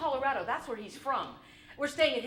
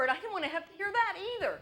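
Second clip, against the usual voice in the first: usual speaking voice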